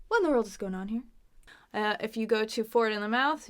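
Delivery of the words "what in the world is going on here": quick, connected. Shown as a demonstration. Teacher models the example question, quick and connected. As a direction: run the question together.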